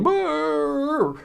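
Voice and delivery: high drawn-out dog moan; hound dog groan. Retold or imitated. Speaker imitating the hound dog's moan for the audience. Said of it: "making this sound".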